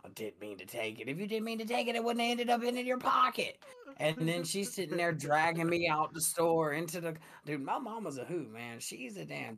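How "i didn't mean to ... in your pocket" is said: mom mocking. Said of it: "And then there's my mama".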